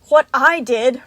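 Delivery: loud, offended retort; indignant snap. A narrator's reading. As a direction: throw the blame straight back. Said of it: "Roxy snapped".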